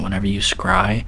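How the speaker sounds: whispering. Real, not acted. Explains scrying to viewers.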